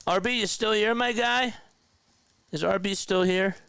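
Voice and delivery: high-pitched check for someone still around; calling out. Real, not acted. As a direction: call out for a missing friend, voice lifted high. Calling out to a viewer.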